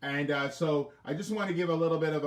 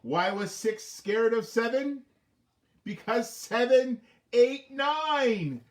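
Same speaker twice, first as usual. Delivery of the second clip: slow, high, louder riddle; slow joke telling; 6 semitones higher, 4 dB louder, much slower than usual. Acted. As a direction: spell it out slowly for the kids. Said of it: "telling the joke".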